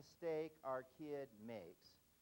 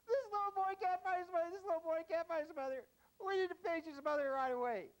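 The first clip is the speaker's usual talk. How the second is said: very high, loud, frantic lady; hammed-up hysterical urgency; panicked rescuer; 7 dB louder than usual. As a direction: raise the alarm in a shrieky high voice. Acted panicked lady at ticket counter.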